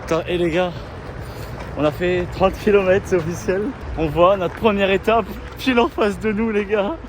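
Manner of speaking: loud and high, thrilled to be there; excited. Real, not acted. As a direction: announce the milestone with a rush of joy. Reaching the first stage, excited. (tout excité, voix forte et aiguë)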